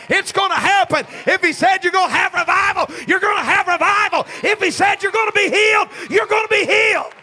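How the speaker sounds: loud, high, rhythmic shouted preaching; rising call-and-response sermon peak; preaching at full force. Acted. Preaching climax.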